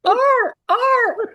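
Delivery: high yelping bark; faint dog bark. Retold or imitated. S1 imitates the far-off dog still barking. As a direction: yelp like a distant dog.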